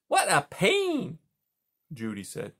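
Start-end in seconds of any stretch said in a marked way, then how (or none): 0.1-1.2 a sleepy beaver calling across the pond. Acted. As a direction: beaver asking who's there, pitched up. Beaver dialogue.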